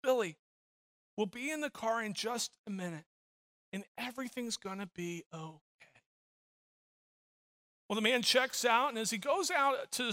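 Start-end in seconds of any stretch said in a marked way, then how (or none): 0.0-5.6 soft, measured reassurance, trailing quieter; calm yourself down aloud, gently and quietly. Retold or imitated. Father at the checkout beside his screaming son, very calmly. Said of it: "very calmly, he hears the father say".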